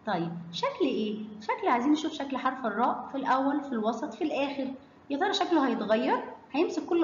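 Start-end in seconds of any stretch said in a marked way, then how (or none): none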